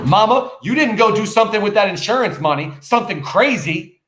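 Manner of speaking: loud, high accusing shout at his mother. Acted. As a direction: slam the fist down and yell it. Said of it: "Bringing his fist down and shouting".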